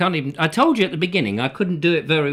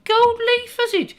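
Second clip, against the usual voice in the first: cartoonish falsetto, far above own pitch; high mock voice; 4 dB louder, slower than usual. Chatting to gangster about decorating, very high mock voice. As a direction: ask in a silly high voice. Second character in two-voice skit.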